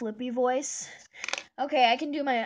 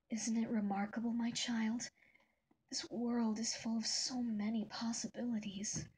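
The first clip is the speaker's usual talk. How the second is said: soft, half-whispered, dreamy and tender; hushed wonder, like a gentle mother; Toriel as Rose Quartz; 3 semitones higher, 7 dB quieter than usual. Acted. Imagining Toriel voiced like Rose Quartz, soft and hushed. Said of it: "imagine Toriel speaking with Rose Quartz's voice".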